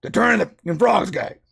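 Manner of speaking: mock Alex Jones rant, lightly done; imitating a ranting radio host; Alex Jones impression. Mocking Alex Jones on the iceberg, lightly voiced. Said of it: "Speaking of Alex Jones".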